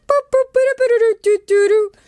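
high, loud "boop boop" horn imitation; horn noises. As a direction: honk like a clown bike horn. Imitating clown bicycle horns.

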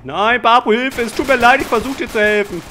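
high, loud panicked pleading; panicked shouting. Real, not acted. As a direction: cry out desperately for your partner. Partner is being caught. (panisch, laut und hoch rufend)

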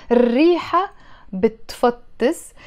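ordinary delivery

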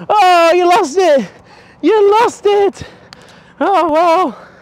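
high, slow mock-pity tease; mock sympathy. Trainer mock-consoling the dog who lost the toy, high slow tease. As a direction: pretend to feel sorry, high and drawn out.

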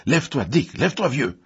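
high, loud urging; urgent shout. A narrator's reading. Elliot urges his fallen cousin to rise, urgent shout. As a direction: yell for him to get up. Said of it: "cria-t-il".

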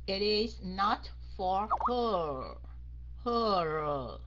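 "her" low and held long into the R; slow right down and stretch "her"; drawn-out ending. Shown as a demonstration. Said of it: "Cái âm O phải kéo dài phía sau".

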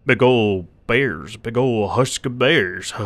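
slow, savoured bear talk; drawn-out. Pretend. Gamer relishes the bear warning, slow drawn-out words. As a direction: stretch out every word.

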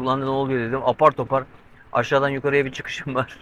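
ordinary talk, nothing marked

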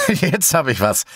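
viel höher, freudig ausgerufen; freudiger Ausruf. A narrator's reading. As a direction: wie bei einem plötzlichen Einfall. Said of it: "rief ich freudig aus". (froh ausgerufen, viel höher)

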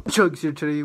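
shouted hype opener, energetic; loud hype intro. Real, not acted. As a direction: open the video with a loud shout. Hyped intro to second chug.

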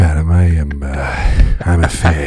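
low, breathy Harrison Ford voice; Harrison Ford impression. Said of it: "It's the breathing that does it".

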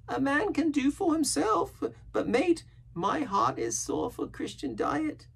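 slow, very high sailor voice; wistful marooned castaway. A narrator's reading. Castaway confiding his dreams of cheese, longing. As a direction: ramble longingly in a high, drawn-out voice.

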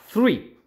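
"three" with a lisped Spanish z, like "thrui"; pronunciation demo. Shown as a demonstration. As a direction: start "three" with a th sound like the Spanish z. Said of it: "como con una Z al inicio".

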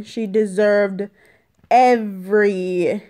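normal talking voice

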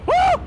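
high shrill woo; loud whoop. Real, not acted. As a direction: yelp in sudden surprise. Yelp of surprise.

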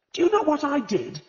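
high, loud, indignant demand; wrathful demand. A narrator's reading. Agatha confronts the vicar's wife, wrathfully demanding. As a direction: fire the question at her in outrage. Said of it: "demanded Agatha wrathfully".

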